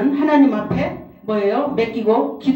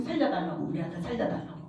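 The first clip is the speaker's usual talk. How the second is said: hushed imitated plea; 5 semitones lower, 12 dB quieter, faster than usual. Reenacting desperate prayer for son.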